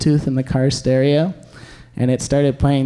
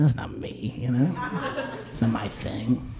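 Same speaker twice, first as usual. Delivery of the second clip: whispered, much softer, almost breathless; hushed whisper; 3 semitones lower, 10 dB quieter than usual. Retold or imitated. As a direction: let it drop to a private whisper. Rejecting office job paycheck name.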